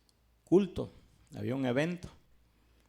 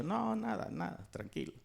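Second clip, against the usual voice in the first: quiet brush-off; 5 semitones higher, 8 dB quieter than usual. Retold or imitated. Brother caught him crying at work, quiet brush-off. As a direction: play it down softly, nothing to see.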